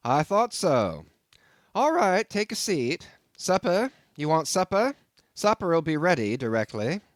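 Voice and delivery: bustling host offering supper; cheery landlord. A narrator's reading.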